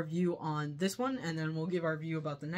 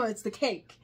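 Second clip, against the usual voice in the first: ordinary delivery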